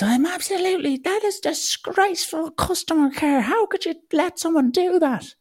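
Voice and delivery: high, far outraged woman voice. Retold or imitated. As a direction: play the furious passenger, very high. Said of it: "And she goes".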